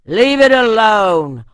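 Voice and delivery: loud, slow warning cry; a frightened warrior crying out loud; cried out. A narrator's reading. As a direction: call out to stop him, drawn out. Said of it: "one of the other men cried".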